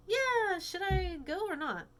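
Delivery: put-on high, loud girl voice; Claire voice. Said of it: "And so Claire goes".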